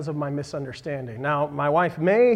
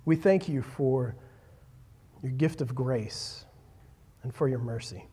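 prayer; 5 semitones lower, 6 dB quieter than usual. Acted. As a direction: pray quietly and humbly before God. Opening prayer before the sermon.

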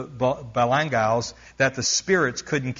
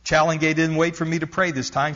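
normal talking voice

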